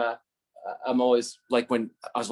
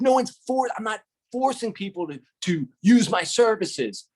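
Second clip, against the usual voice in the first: defensive protest; 10 semitones higher, 3 dB louder than usual. Retold or imitated. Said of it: "I'm like".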